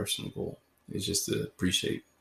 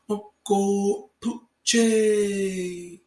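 very high, very slow, stretched host name; showy podcast sign-off; drawn-out sign-off; 12 semitones higher, 7 dB louder, much slower than usual. Pretend. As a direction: sing out your own name like a showman. Host's signature sign-off name.

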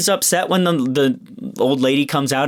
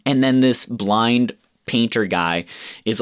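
normal talking voice

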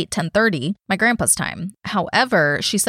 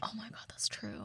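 stunned whisper; 3 semitones higher, 23 dB quieter than usual. Real, not acted. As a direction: breathe it out in shock. Stunned agreement.

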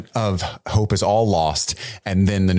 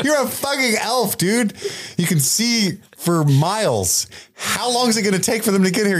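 much higher, incredulous rant at the elf; exasperated disbelief; 11 semitones higher than usual. Real, not acted. Mocking the elf who can't see far, incredulous. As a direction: scoff at him, you can see for miles.

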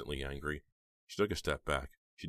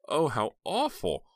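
high dismay; 9 semitones higher, 6 dB louder, slower than usual. A narrator's reading. Heather reacting to horror.

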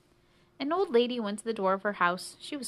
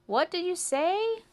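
high, loud oblivious question; cricket who can't hear; 10 semitones higher, 5 dB louder than usual. Acted. Chirping cricket asks the mouse to repeat, oblivious. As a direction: pipe it up over the music.

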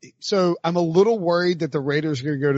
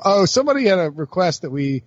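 ordinary delivery

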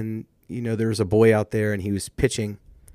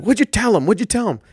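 high, louder urgent question; dad coach eagerly asking what she said, pitched high; 9 semitones higher, 4 dB louder than usual. Retold or imitated. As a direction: demand the secret right away. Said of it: "He's like".

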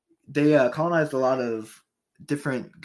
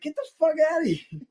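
mocking angry townsfolk; 13 semitones higher, faster than usual. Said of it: "they're just like".

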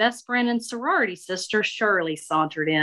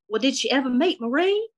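sweetly nosy Southern belle; Shirley approving Marie's shoes, Southern lady voice; 7 semitones higher than usual. Acted.

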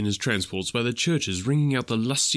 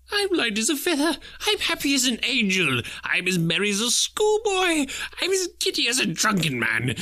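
high, joyous cries building to a shout; giddy Scrooge; 13 semitones higher than usual. A narrator's reading. Scrooge overjoyed in his bedroom, shouting greetings to the world. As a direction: whoop it to the whole world.

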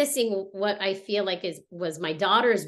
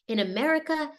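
higher, quickened voice of a supportive mother; imitating mom, pitched up and insistent; mother's reassurance; 5 semitones higher, faster than usual. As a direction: urge her on in a lively quoted voice. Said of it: "and she's like".

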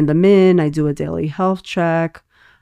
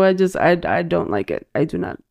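ordinary delivery

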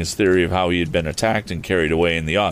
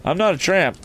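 higher-pitched, mildly raised protest; prompt call; 11 semitones higher than usual. Acted. Tom answering the suspicious farmer, high mild call. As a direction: call back a quick denial. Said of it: "called Tom promptly".

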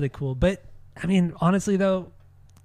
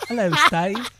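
mock Kiwi accent; 6 semitones higher, 3 dB louder than usual. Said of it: "that last accent was more Australian".

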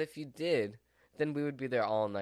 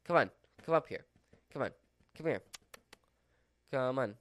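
calling the dog; faster than usual. Real, not acted.

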